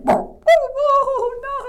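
high, loud whoops of panic; frightened wailing. Acted. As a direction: wail in comic terror. Boy wails in fright.

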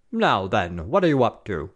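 lightly voiced lieutenant's nagging; mock idiotic officer. A narrator's reading. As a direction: mimic a pompous fool, lightly. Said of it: "They mimicked the idiotic voice".

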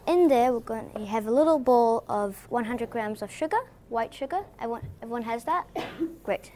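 ordinary talk

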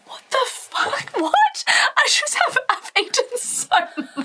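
high, squeaky disbelief, stuffed to bursting; mock overstuffed husband. Essie shocked at the late barbecue, high squeaky disbelief. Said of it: "And he was like".

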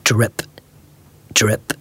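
low, isolated imitated drips; dripping tap. A narrator's reading. Kitchen tap disturbing Mister Bear, low imitated drips. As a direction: drip like a leaky tap. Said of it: "went the leaky kitchen tap".

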